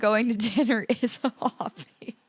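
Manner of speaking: real giggle overtaking the sentence; laughing admission. Real, not acted. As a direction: break into laughter on the last words. Laughs at her own exception.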